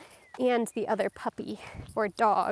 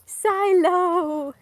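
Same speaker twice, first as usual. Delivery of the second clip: long, high, loud call; 8 semitones higher, 8 dB louder than usual. Real, not acted. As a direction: holler the dog's name, drawn out. Calling the dog from afar.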